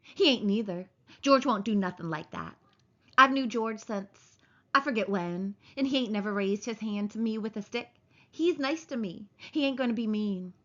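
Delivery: usual speaking voice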